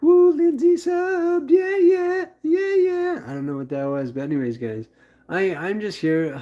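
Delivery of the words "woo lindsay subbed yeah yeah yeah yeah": much higher, louder whooping voice; wild celebration. Acted. Celebrating Lindsay's sub, much higher and louder whoop. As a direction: whoop it like a hype man. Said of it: "I'm gonna be like".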